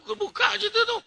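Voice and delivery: high laughing mock woman's voice; high giggling imitation of a nagging wife; laughing falsetto woman.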